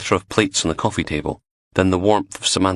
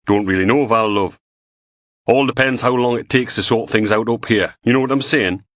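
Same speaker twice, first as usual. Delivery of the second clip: louder, higher blokey phone voice; Graham on the phone; 5 semitones higher, 3 dB louder than usual. A narrator's reading. Graham fobbing off his wife Val on the phone, loud and blokey. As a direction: brush her off, matey and loud.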